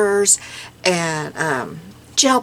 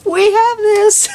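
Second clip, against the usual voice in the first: overjoyed she finally has a store nearby; excited squeal; 13 semitones higher, 4 dB louder than usual. Retold or imitated. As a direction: burst out thrilled. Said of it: "was like".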